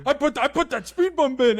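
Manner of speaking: high, hammed-up boasting; mock self-satisfied boast; proud brag. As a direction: brag like you saved the neighborhood. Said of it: "he's like".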